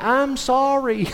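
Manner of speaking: high, drawn-out mock singer's apology; real loud apology. Preacher mimicking a lady apologizing mid-hymn, real loud. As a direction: call it out slowly over the singing, imitating. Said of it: "and real loud in the song".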